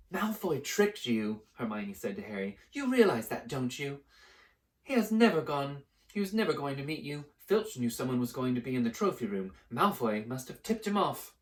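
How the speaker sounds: put-on high, sharp know-it-all girl; scolding girl. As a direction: lecture him indignantly. Hermione scolding.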